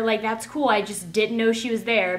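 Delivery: ordinary delivery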